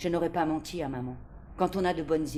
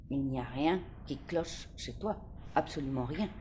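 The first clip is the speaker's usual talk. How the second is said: softer, low, gentle certainty; tender reassurance; 3 semitones lower, 6 dB quieter than usual. A narrator's reading. Librarian looks her in the eyes, tender and soft. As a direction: comfort her completely. Librarian comforts crying girl.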